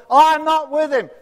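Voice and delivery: loud heated denial; angry denial. Acted. Peter denies knowing Jesus to accusers, loud furious denial. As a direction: shout the denial, cornered and furious.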